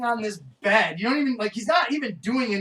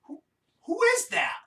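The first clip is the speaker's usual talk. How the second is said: high, panicky voice; Charlie. Retold or imitated. Retelling Charlie hearing the narrator, a high panicky voice. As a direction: Charlie spooked by the voice. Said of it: "he's like".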